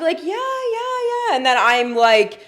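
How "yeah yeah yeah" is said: high quick yeses, playing along; mock agreeing. Waxer mimics herself nodding along to a misunderstanding. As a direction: squeak out easy agreement. Retelling going along with lie.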